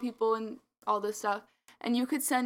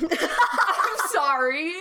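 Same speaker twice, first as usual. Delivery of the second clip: slow, drawn-out, laughing loudly; laughing apology; 7 semitones higher, 9 dB louder, much slower than usual. Real, not acted. To her co-host about cursing, a slow laughing sorry. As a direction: apologise while cracking up.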